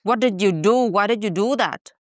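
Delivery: ordinary talk, nothing marked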